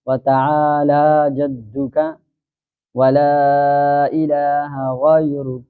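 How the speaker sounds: drawn-out, chanted recitation of the prayer; slow prayer recitation. Shown as a demonstration. Teacher reciting the opening prayer slowly for students.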